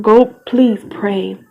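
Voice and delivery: ordinary talk, nothing marked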